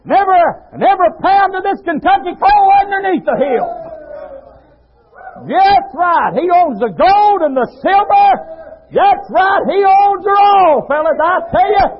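high, loud-pitched exhortation; full-force preaching. Real, not acted. Preacher exalting God's riches to congregation: high shouted preaching.

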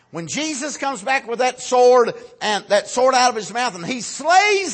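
high, loud triumphant proclamation; full-force preaching. Acted. Preacher proclaims Jesus slaying the wicked, triumphant shouted preaching. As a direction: drive it upward like a victory cry.

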